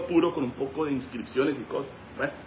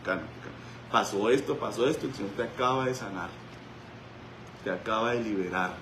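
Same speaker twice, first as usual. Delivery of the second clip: softer, lower reassuring voice; calming; 3 semitones lower, 6 dB quieter than usual. Retold or imitated. Pastor calms the freed woman on the floor, soft and reassuring. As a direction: soothe her gently down.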